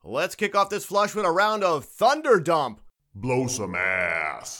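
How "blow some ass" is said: deep slow announcer. Acted. Segment sting after title.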